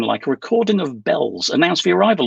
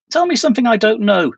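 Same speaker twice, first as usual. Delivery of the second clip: irritated, higher and a bit louder; cross; 8 semitones higher, 4 dB louder than usual. A narrator's reading. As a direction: snap back at her, fed up. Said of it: "she said crossly".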